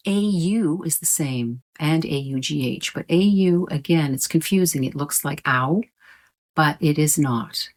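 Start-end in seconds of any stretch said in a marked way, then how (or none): none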